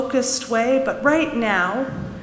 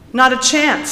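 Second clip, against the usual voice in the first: indignant refusal; 5 semitones higher, 6 dB louder than usual. A narrator's reading. Girl refusing to forgive.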